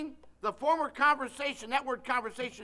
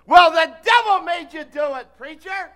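mock excuse; 6 semitones higher, 18 dB louder than usual. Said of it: "we'll say".

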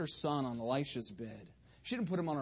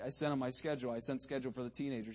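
normal talking voice

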